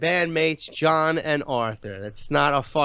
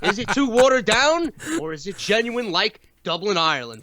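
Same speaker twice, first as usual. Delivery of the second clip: mock promo announcer; 4 semitones higher than usual.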